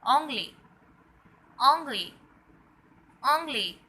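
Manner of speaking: "anglais" said loudly, nasal ong-lay; loud classroom drill of "anglais"; French drill. Shown as a demonstration. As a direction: repeat "anglais" clearly and loudly. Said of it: "we say".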